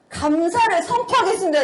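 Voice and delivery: high, firm retold proclamation; declaring thanks. Declaring thanks to God instead of irritation, high emphatic proclamation. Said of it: "이렇게 선포를 했거든요".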